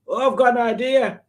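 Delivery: high-pitched eureka; sudden bright idea. Acted. Acting out someone getting an idea, high and excited. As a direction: pipe up high as if inspiration just struck. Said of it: "if you get an idea, you go".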